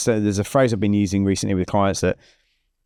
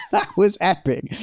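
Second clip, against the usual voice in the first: high, drawn-out laughing cheer; exhilarated; 9 semitones higher, slower than usual. Retold or imitated. Rider coming off on video, exhilarated laughing re-enactment.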